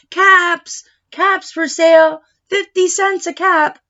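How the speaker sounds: high, loud hawker's cry; street vendor calling. Acted. Peddler crying his wares down the street, loud calling. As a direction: call the caps out to the whole street.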